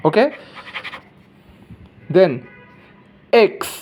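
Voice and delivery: ordinary talk, nothing marked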